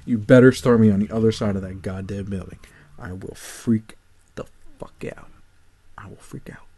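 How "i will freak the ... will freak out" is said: very soft, tense warning; hushed, simmering exasperation; muted threat. Real, not acted. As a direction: drop almost to a whisper to threaten. Threatens to lose temper.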